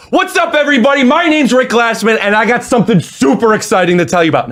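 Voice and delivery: high-pitched hyped-up announcer intro; super-hyped social media hype voice; high energy. Shown as a demonstration. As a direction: belt out an over-excited video opener. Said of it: "you're gonna do a high energy".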